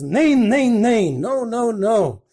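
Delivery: high, drawn-out protest in retold dismay; upset. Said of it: "gets all upset and he goes".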